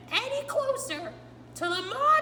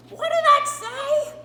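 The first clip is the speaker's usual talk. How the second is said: much higher, much louder and slow: "What did THAT say?"; alarmed shout; 7 semitones higher, 13 dB louder, slower than usual. Acted. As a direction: Grover jolting in alarm at "that". Grover startled by the title.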